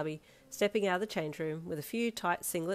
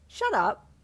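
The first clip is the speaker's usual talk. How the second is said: loud, high retort; indignant protest; 6 semitones higher, 8 dB louder than usual. Acted. Rejecting Darby's baby-face tease, loudly indignant. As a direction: bat the teasing away sharply.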